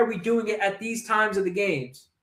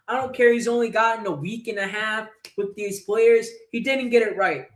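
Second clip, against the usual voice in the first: ordinary talk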